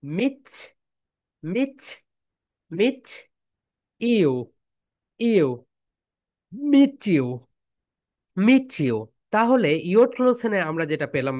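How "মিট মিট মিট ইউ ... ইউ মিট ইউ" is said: মিট ইউ run together as meechu, rising; meet you blended. Shown as a demonstration. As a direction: drill মিট ইউ with the t turning into ch. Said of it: "সবাই বলো". (মিট ইউ মিশিয়ে মিচু বলা)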